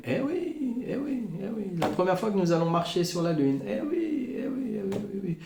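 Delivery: high, mocking eh oui; sarcastic mock. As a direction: sneer along ironically. (eh oui moqueur et ironique, voix haute)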